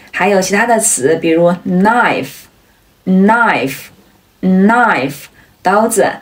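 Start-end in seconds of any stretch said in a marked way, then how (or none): 1.7-5.1 "knife" said slowly as "nife"; silent K. Shown as a demonstration. Teacher giving more examples, "knife" with silent K. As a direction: begin "knife" on the N, with pauses between. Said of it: "开头的K都是不发音的".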